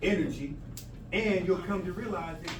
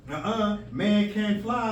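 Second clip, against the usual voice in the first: loud doubter; 4 semitones higher, 6 dB louder, slower than usual. Acted. Voicing a doubter.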